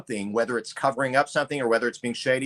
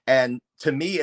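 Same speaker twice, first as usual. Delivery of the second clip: ordinary talk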